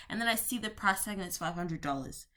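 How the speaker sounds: ordinary talk, nothing marked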